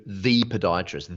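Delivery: THE said long as "thee"; stress on THE. Shown as a demonstration. Coach lifting a client to top expert, stress on THE. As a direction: lean on THE, drawn out as "thee". Contrasts the one with a.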